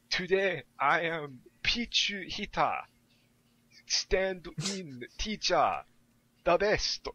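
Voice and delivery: high, slow, beaming teacher voice; excitable Japanese teacher. Retold or imitated. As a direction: announce yourself like a proud teacher. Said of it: "just goes".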